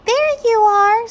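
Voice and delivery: put-on high, loud, slow relieved girl; little girl voice. A narrator's reading. A little girl greeting her found cat, high and relieved. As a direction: sing it out like a relieved child.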